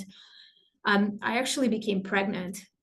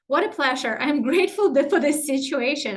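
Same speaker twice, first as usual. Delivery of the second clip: gets louder, laughing through the thanks; laughing gratitude; 5 semitones higher, 5 dB louder than usual. Shown as a demonstration. Coach demonstrating to the class, louder and laughing. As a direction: cheerfully thank the chaos with a laugh.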